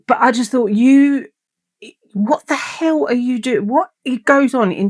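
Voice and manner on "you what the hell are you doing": high, drawn-out incredulous question; exasperated disbelief. Retold or imitated. Baffled by partner talking in his sleep, incredulous. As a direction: demand an explanation in baffled disbelief.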